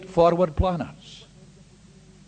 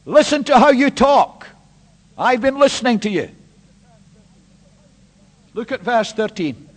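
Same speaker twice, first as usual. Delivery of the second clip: loud, higher, driving sermon voice; full-force preaching; 4 semitones higher, 8 dB louder than usual. Real, not acted. Preacher confronting the congregation with verse thirteen, loud. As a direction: hammer the scripture home.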